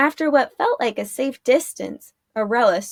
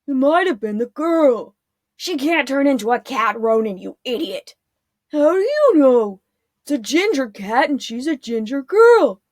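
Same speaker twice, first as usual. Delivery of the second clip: dim guard; 3 semitones higher, 5 dB louder than usual. A narrator's reading.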